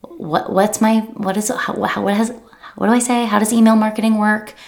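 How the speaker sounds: flustered mock panic. Coach imitating a flustered client unsure what to email. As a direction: act out a panicked beginner. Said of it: "we're like".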